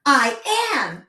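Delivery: loud, high, imitated child's retort; yelling back. Daughter yelling back at her mom, defiant. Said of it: "yells back to me".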